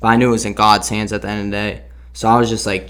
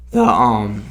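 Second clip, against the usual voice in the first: voice cracking. Real, not acted. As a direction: voice breaks on the words. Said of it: "sorry for my voice crack".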